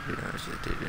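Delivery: unvoiced whispered repeat; hushed whisper while hunting. Real, not acted. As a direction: whisper it, sneaking up on him. Creeping up on hiding enemy.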